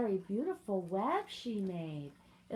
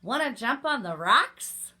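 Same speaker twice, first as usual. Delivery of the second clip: loud storybook goat question; goat character; 2 semitones higher, 12 dB louder than usual. A narrator's reading. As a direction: call out the goat's invitation. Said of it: "said the goat".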